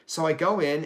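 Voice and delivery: ordinary delivery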